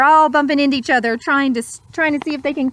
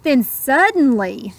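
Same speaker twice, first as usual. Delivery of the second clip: put-on slow, lilting read-aloud to children; gentle rhyming storyteller; storybook narration; 4 semitones higher, slower than usual. A narrator's reading. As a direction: savour each word like a bedtime story. Rhyming picture-book narration.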